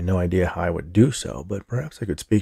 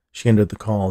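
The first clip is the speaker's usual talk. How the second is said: ordinary delivery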